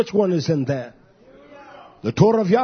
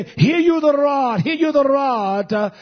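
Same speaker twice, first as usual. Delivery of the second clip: scripture declaimed; 4 semitones higher, slower than usual. Acted.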